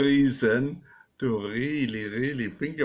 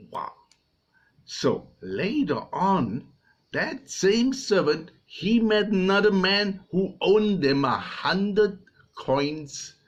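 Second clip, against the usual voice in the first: ordinary delivery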